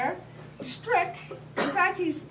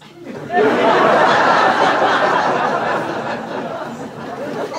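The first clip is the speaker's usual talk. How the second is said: loud mock wailing sob; over-the-top fake sob; hammed-up sob; 12 dB louder than usual. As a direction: break into a theatrical sob. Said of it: "It is okay to cry".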